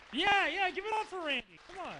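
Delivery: an excited shout-out to the crowd; hyped call-out. Real, not acted. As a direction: pump the room up for Randy. Hyping crowd to cheer Randy.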